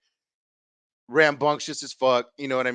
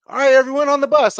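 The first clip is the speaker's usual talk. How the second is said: high, loud call to board the bus; a teacher herding kids, voice up and loud; 11 semitones higher, 9 dB louder than usual. Retold or imitated. Principal calling kids onto bus.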